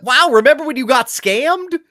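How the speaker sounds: sarcastic. Retold or imitated. Game text voiced mockingly.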